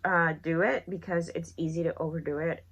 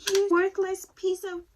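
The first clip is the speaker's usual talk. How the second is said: retold high-pitched angry insult; hammed-up high scolding; mad; 13 semitones higher, 3 dB louder than usual. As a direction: imitate yourself berating a partner, voice way up. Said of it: "I was mad".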